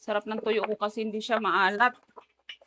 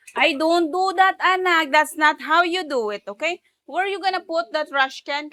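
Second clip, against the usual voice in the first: loud, raised-pitch telling-off; parental scolding; 6 semitones higher, 6 dB louder than usual. Pretend. Parent to child over the trash can, loud scolding. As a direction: scold high and loud, stretching the words.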